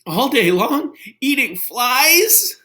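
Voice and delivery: high, rising worried voice; picture the horror, voice climbing; high, fretful Gerald voice; anxious Gerald. Acted. Gerald imagines being a frog.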